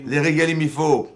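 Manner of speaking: lance « Les régalim » fort et haut; martèle le mot comme un rappel à la classe; loud emphasis. Real, not acted. Stressing the festival word. (insistance forte et aiguë)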